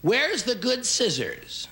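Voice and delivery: high, slow, put-upon mom whining; exasperated parent. Retold or imitated. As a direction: whine it like a fed-up mother hunting her things. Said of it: "heard this phrase in your house".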